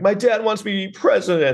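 high-pitched mock complaint about dad; hammed-up, high whine of a put-upon son; whining movie son. Mocking a film's son griping about his dad, high whine. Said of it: "So it's the same thing".

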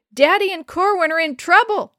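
high, loud cry for help; screamed alarm. A narrator's reading. Mother on the shore to oldest brother, screaming in alarm. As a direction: scream the emergency to someone down the shore. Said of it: "she screamed".

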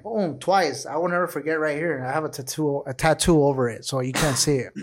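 ordinary talk, nothing marked

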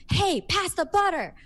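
high, loud barked order; mock rude demand at dinner; bossy demand. Shown as a demonstration. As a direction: snap it as a command. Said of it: "you're also not like".

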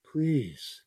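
ordinary talk, nothing marked

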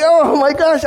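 high, loud cry of dismay; acted-out high panicked outburst; retold panic. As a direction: exclaim in horrified disbelief. Retells his panic at losing it.

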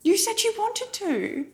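very high, insistent self-defence; indignant protest. Real, not acted. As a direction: argue back high and rising in volume. Bickering over who wanted the film.

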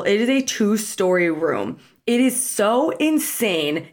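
drawn out, very slow, in awe; slow and awed. Real, not acted. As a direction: stretch each word, stunned by the room. Awed description of the hotel room.

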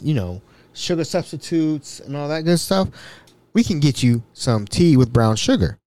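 ordinary talk

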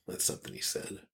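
breathy whispered aside; a hushed whisper tacked on after the quote. Real, not acted. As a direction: drop to a whisper for the side comment.

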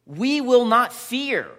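loud, slow, defiant proclamation; bold declaration. Acted. Preacher proclaiming to the congregation, loud and slow. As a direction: plant each word: we will not fear.

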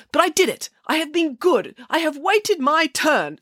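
high, self-righteous lady; indignant nanny voice. Acted.

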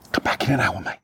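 imitated urgent whisper; hushed, breath-only request. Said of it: "we're whispering".

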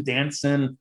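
normal talking voice

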